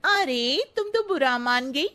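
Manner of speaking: mock her makeover; snide auntie. Auntie retorts.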